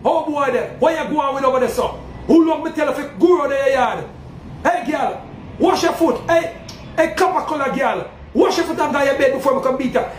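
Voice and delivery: put-on a yard elder shouting the children to bed in patois; hint of a Jamaican patois yard voice. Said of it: "I have to talk to you in the patois language".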